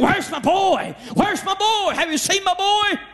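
high, mock-shouted cries for his son; screaming father. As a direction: call out desperately for the boy. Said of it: "weeping and screaming".

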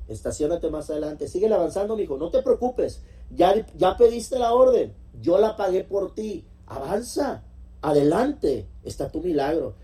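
mucho más agudo y fuerte, animando a avanzar; voz de Dios empujando con entusiasmo. Acted. Dios habla en la parábola. (Dios anima al creyente a avanzar: entusiasta y agudo)

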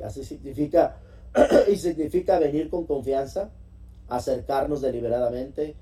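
normal talking voice

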